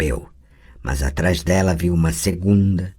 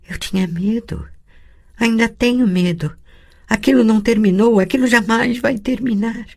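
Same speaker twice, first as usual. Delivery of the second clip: put-on high, scared girl's voice; a little girl reliving a nightmare; frightened child; 13 semitones higher than usual.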